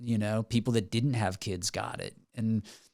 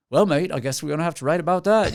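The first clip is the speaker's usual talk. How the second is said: higher, louder mock British accent; bad British accent; 6 semitones higher, 6 dB louder than usual. Songwriter imitating his British co-writer, bad British accent. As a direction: put on a hammy British accent. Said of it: "that's my bad British accent".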